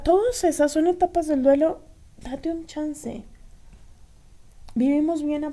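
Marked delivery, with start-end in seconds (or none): 2.2-3.2 slow, much softer, tender; slow soft reassurance; gentle advice. Real, not acted. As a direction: ease it out softly and slowly. Gentle advice to grieving listeners.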